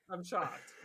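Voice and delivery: low, flat deadpan repeat. Pretend. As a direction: say it dry, unimpressed.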